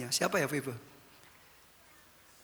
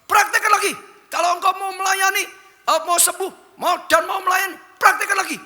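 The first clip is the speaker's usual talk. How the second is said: high, loud booming challenge; God's commanding voice; 10 semitones higher, 6 dB louder than usual. Acted. God challenging the retired pastor, loud high booming command. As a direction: thunder it down as God speaking to his servant. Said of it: "Tuhan berkata".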